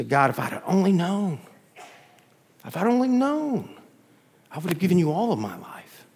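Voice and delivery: restrained lament, rising high on "known"; regretful cry. Acted. Believer in heaven telling God, regretful. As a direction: plead with regret, without raising the volume.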